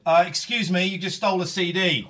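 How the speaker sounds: loud, stern call-out; loud accusing. Retold or imitated. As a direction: call out the thief loudly. Stallholder catches the thief.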